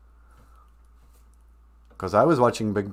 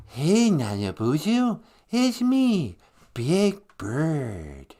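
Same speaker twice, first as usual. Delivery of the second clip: slow, swooping high puppet voice; drawn-out, badly faked children's-show bird; Big Bird; 4 semitones higher, much slower than usual. Caller greeting the podcast host, put-on slow bird voice. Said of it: "you don't sound the way I remember".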